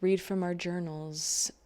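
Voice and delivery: ordinary talk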